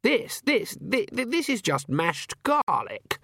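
high, stuttering disbelief; pitched far up, stumbling then drawing it out; spluttering outrage. Acted. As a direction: splutter in horror at the taste. Guest appalled by garlic food.

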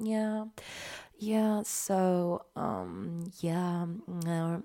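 smacky, soft celebrity murmur with drawn-out fillers; Kylie Jenner. Retold or imitated. Celebrity in an interview, unintentional ASMR, smacky impression. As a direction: let mouth sounds stick to quiet, lazy yeahs. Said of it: "die ist dann ja ganz oft so schmatzig".

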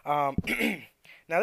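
ordinary delivery

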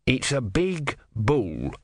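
slow, odd little tortoise voice; a grumpy old tortoise, slowly. Acted. Tortoise voice in narration.

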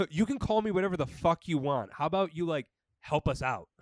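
a loud, exasperated mock rant; mock yelling. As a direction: yell it back at them.

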